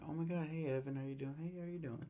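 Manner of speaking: hammed-up cheery hello to an old friend; retelling the film, a hint of Kaylee greeting Evan cheerily; friendly waitress greeting.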